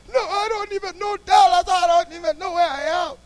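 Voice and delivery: high, loud mock wail; imitated crying. Stranded man pleading that he is lost, imitated high crying. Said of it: "he's crying".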